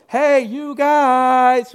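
high, loud, drawn-out hail; calling out. Retold or imitated. Jesus hailing fishermen from the shore, loud call. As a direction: call across the water to the fishermen. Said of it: "He's yelling from the coast".

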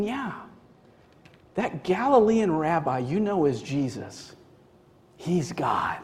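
soft, grave reply; quiet, weighty reveal; hushed confirmation. Acted. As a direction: confirm it gently, dropping to a near whisper. Acted reply to the astonished listener.

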